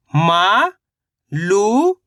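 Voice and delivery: मा and लू each drawn out and rising; sound by sound. Shown as a demonstration. Teacher sounding out a word for learners, syllable by syllable. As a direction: stretch मा ... लू with a pause between. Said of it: "और मा मिलके बन जाएगा".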